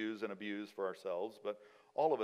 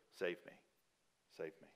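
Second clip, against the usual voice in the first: "Save me" said softly, fading lower; hushed plea; 4 semitones lower, 10 dB quieter than usual. Retold or imitated. Quiet repeat of the psalmist's plea.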